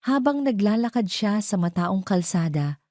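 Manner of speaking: ordinary delivery, nothing marked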